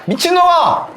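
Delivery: high furious shout; angry yelling. Retold or imitated. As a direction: yell curses while dragged away. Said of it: "험하게 막 법정 구속돼서 가면서". (높고 격한 고함)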